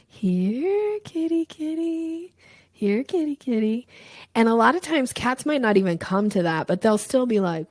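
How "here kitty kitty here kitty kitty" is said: higher, slow, coaxing kitty call; calling a cat. Shown as a demonstration. Coach demonstrates catcalling energy, high and slow coaxing. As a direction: lure the cat in gently, drawn out. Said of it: "calling a cat, you go".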